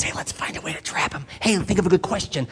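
hushed, plotting half-whisper; conspiratorial whisper. Retold or imitated. Pharisees plotting against Jesus among themselves, whispering. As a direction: whisper it like a schemer setting a trap. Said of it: "they were whispering about Jesus".

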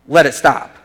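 loud, firm demand; short emphatic command. Shown as a demonstration. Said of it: "but it's, it's more like".

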